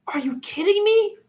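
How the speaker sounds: high, disbelieving outburst; can you believe this, squealed; incredulous. Real, not acted. Ranting in disbelief.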